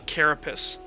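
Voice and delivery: ordinary delivery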